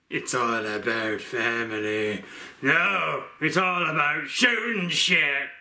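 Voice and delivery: a hint of action-movie hardman; Vin Diesel impression. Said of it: "my Vin Diesel impression".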